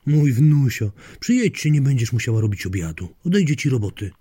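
high, loud, pleading persuasion; coaxing grandmother. Acted. Grandmother talks daughter into coming for dinner, coaxing. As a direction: gush and coax, pitch swooping up.

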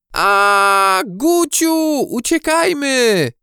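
very high, loud, drawn-out shriek; panicked scream. A narrator's reading. Maja warns Gucio of the hurtling thing, panicked scream. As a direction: scream a warning to flee. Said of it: "wrzasnęła Maja".

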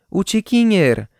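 put-on loud, high-pitched little girl's call. A narrator's reading. Girl spotting her runaway cat, calling out. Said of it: "zawołała dziewczynka".

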